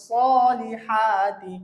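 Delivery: সলিহাতি slow with long vowels; throat h. Shown as a demonstration. Teacher summing up how to say it, drawn out. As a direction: push the 'h' of সলিহাতি from the throat. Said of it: "আমাদের বলতে হবে".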